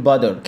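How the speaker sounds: "butter" with the t softened to a d. Shown as a demonstration. Teacher showing learners the sound, "butter" with d for t. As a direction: flap the t in "butter" between the vowels. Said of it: "التي لما تيجي بين حرفين متحركين منطقة دي".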